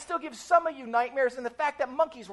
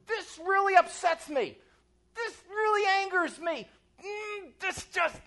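high, strained vent of frustration; groaning with mock exasperation; pent-up frustration; 7 semitones higher than usual. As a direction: act out being fed up, held in rather than yelled. Acting out pent-up daily frustration.

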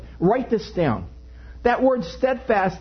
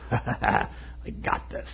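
cool confident hero; 9 semitones lower, 8 dB quieter, slower than usual. Retold or imitated. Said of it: "with a glint in his eye".

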